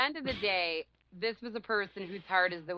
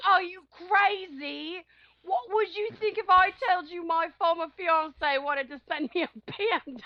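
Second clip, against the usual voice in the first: mock angry boyfriend; 9 semitones higher than usual. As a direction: parody his jealous outburst, high and mocking. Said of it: "He was so angry".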